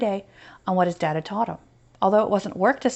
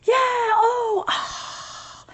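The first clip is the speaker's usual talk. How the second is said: high mock crowd roar fading to breath; crowd screaming; much slower than usual. Boy imitating a cheering crowd, high mock roar. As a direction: cheer like a whole arena, then let it trail off. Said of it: "[name] yelled in his best crowd screaming voice".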